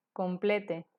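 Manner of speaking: "complete" leído a la española, com-ple-te; pronunciación española. Shown as a demonstration. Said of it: "Si fuera en español". ("complete" en tres sílabas, como palabra española)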